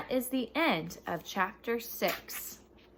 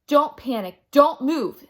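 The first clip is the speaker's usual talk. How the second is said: urgent warning; 6 semitones higher, 10 dB louder than usual. A narrator's reading. Jack warns Annie near dinosaur.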